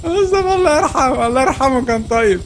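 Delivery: hammed-up laughing lament for a friend; mock mourning. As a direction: wail mock grief through laughter. Mock mourning fallen teammate.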